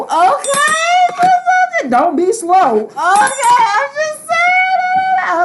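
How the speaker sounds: high, loud, sassy squeal; sassy put-on voice. Reader teasing slow viewers in a high sassy squeal. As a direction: squeal it out with mock sass.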